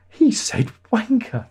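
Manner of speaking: mock British shock, drawn out; shocked splutter. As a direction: sound stunned as if choking on tea. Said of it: "spluttered into their tea and went".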